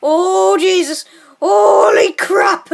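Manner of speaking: loud exclamations as they fall; panicked shouting. Real, not acted. Tumbling down the mountain with his friend, loud panicked cries. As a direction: cry out in real alarm.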